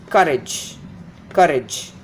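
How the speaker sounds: courage as CUR-ij; first-syllable stress. Shown as a demonstration. Teacher showing the root word, courage stressed on COUR. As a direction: hit COU in courage, short falling ending. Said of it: "Here the first beginning syllable is stressed".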